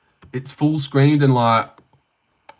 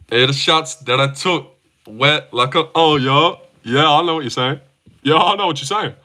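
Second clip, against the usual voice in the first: lightly put-on basketball star voice; a hint of an athlete's casual talk; LeBron; 2 semitones higher than usual. Streamer trying a LeBron impression for chat, lightly imitated voice. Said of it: "That was my LeBron impression".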